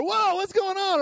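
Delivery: hammed-up shock at the ceiling; playful put-on amazement; mock bewildered whoa.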